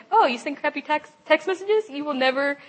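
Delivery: lightly voiced taunting inner critic; Ted. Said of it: "then Ted came in to the picture".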